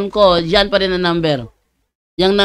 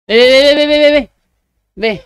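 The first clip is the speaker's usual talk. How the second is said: imitating people racing through the rosary, loud and high; mocking hurried rosary chatter; mock rushed babble; 6 semitones higher, 5 dB louder, faster than usual. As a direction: gabble nonsense syllables fast and loud like a rushed prayer. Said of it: "Huwag maghabulan".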